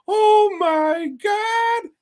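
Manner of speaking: very high, slow drawn-out gasp; campy high oh my God, drawn out; mock horror gasp.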